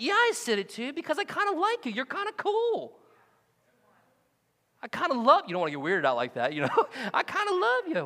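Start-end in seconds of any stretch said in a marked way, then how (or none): 0.0-5.4 playful high. Retold or imitated. 7.1-8.0 playful high. Retold or imitated.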